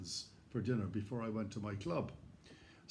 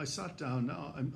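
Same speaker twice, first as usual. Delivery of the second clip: ordinary talk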